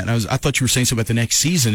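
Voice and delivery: usual speaking voice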